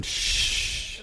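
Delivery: unvoiced shushing hiss; a long taunting shh. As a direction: shush the crowd, finger to lips.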